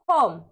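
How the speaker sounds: होम with a full round "oh"; long O. Shown as a demonstration. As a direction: say होम as "hohm", silent final e. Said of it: "ओ का प्रयोग लेंगे".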